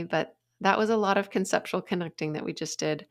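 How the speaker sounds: ordinary delivery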